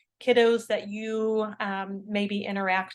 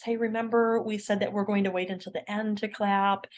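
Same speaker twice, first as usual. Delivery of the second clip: normal talking voice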